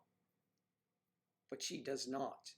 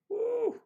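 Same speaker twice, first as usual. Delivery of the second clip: excited whoop; 11 dB louder than usual. Real, not acted. Cheering for the film.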